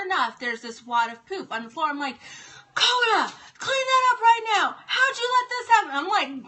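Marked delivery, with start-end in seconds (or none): 2.8-5.9 loud, high re-enacted scolding; retold telling-off. Mom goes off on her son over the mess, re-enacted angry shout. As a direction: act out going off on someone, shouting up high. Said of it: "going off on him".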